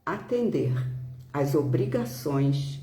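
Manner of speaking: ordinary talk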